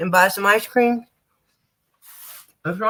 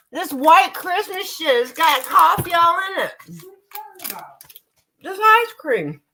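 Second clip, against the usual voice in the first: indignant, much higher pitch; squeaky outrage at the coffee; voice jumps up; 9 semitones higher than usual. Real, not acted. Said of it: "Y'all heard my voice change".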